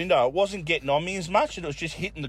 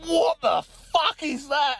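much higher, slow, stunned cry; shocked mate; 12 semitones higher, slower than usual. Retold or imitated. Mate in the driver's seat smells disaster, high slow shocked cry. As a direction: recoil at the awful surprise.

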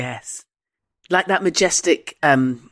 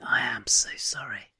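whisper; 5 semitones lower, 9 dB quieter than usual. Real, not acted. Apologising to Scottish listeners.

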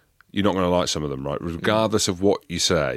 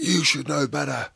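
higher scolding coach voice; 8 semitones higher than usual. Retold or imitated. Coach telling off the player at breakfast, scolding. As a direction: tell him off like a stern coach. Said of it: "And he's like".

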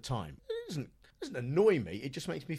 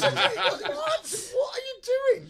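loud, very high-pitched disbelief; incredulous outburst; 8 dB louder, much slower than usual. Real, not acted. Podcaster reacting to an absurd link, incredulous. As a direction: shriek it in comic outrage.

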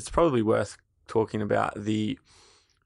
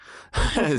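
burst of laughter; 8 semitones higher than usual. Real, not acted. Laughing after shock.

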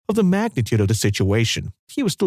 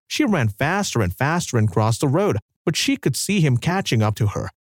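plain reading voice

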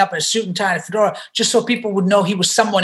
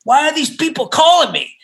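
higher, exasperated mock manager; lightly voiced grumpy manager at two in the morning; irritated manager; 7 semitones higher than usual. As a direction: play the woken-up manager, fed up. Manager woken at night, retold.

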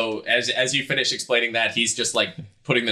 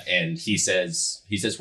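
ordinary delivery

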